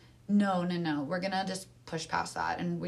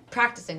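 practicing hit louder and a bit higher on its first syllable; stress on practicing; 4 semitones higher, 5 dB louder than usual. Shown as a demonstration. Stressing practicing to make the listener remember it. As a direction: lean hard into practicing. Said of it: "Let me just emphasize the word practicing".

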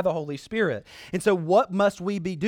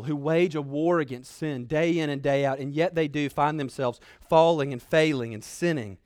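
usual speaking voice

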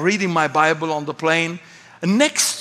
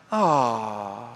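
low, soft "oh" sliding down; mock a whole plane's mild dismay; subdued, deflated little groan; understated Swedish anger; 9 semitones lower, 6 dB quieter than usual. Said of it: "that's Swedish people expressing intense anger".

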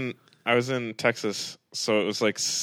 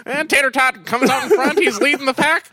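high, loud race caller voice; horse race announcer; 6 dB louder than usual. Pretend. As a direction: call the race like an old-time announcer. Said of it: "a horse announcer from the sixties".

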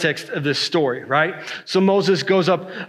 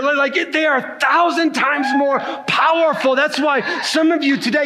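full-force preaching; 5 semitones higher than usual. Real, not acted. Preacher proclaiming blessings to the church, at full force. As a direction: drive the promise home to the congregation.

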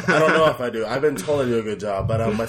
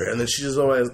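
usual speaking voice

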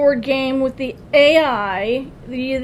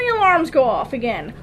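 ordinary talk

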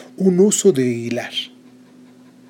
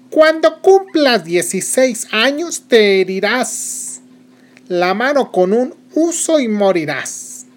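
high, slow, scornful witch curse; contemptuous curse; 8 semitones higher, 4 dB louder, slower than usual. Acted. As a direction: spit out the curse with disdain. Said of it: "con gran desprecio dijo". (maldición lenta y despectiva de bruja)

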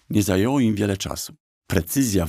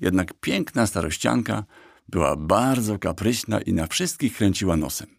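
plain reading voice

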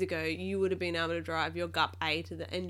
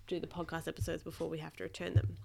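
usual speaking voice

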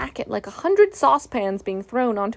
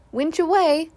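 higher, louder command call; cry out; 6 semitones higher, 4 dB louder than usual. A narrator's reading. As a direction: call out the order. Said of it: "cried Christopher Robin".

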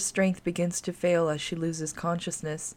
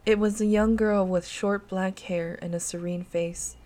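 ordinary delivery, nothing marked

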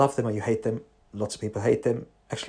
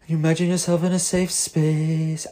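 lightly voiced calm guide; soothing voice; 6 semitones higher than usual. Therapist imitating a soothing hypnotherapist voice. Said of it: "talking in a soothing voice".

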